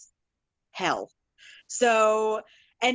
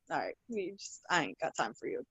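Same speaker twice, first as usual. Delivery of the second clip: low, hushed mock brush-off; dismissive; 5 semitones lower, 10 dB quieter than usual. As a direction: say it under your breath, waving them away. Her dismissive reaction to arguing guys.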